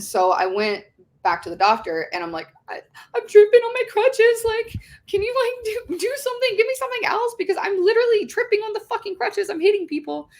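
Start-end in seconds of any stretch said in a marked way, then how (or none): 2.7-10.3 very high mock-tearful plea; imitated hysterical crying. Patient begging the doctor for help, high mock-hysterical voice. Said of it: "I just start hysterically crying".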